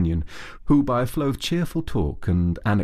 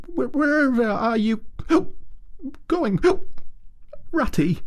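high, alarmed little voice; tearful Mole crying out. A narrator's reading. Mole alarmed as Rat heads off, tearful cry. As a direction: call after him in alarm. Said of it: "cried the tearful Mole".

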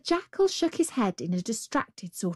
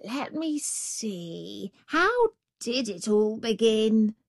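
dawdle over it as if lost; jackal pondering; 3 semitones higher, slower than usual. Acted. Jackal muddles.

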